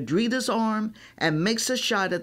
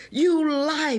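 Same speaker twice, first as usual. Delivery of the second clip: higher, mild angry howl; a touch of a furious howl; 8 semitones higher, 3 dB louder than usual. A narrator's reading. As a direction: accuse the ref, lightly voiced. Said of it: "Brandy howls".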